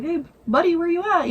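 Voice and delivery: high, lightly acted call to a dog; imitated owner looking for his dog; calling for a pet. Man calls for missing dog.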